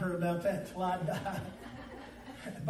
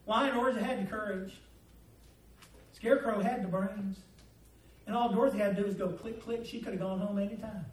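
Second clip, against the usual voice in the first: ordinary delivery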